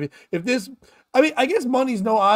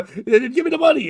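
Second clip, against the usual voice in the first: higher, louder mock protest; mock loud complaint; 6 semitones higher, 6 dB louder, faster than usual. Fake-robbed customer protesting, loud and high. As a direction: cry out in mock outrage. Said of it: "You go".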